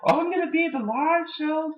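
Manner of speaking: high sarcastic wrestler impression; sarcastic Punk mockery. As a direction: sneer it in a squeaky mock voice. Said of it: "he was saying".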